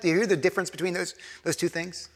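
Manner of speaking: ordinary talk, nothing marked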